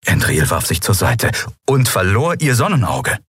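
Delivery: plain reading voice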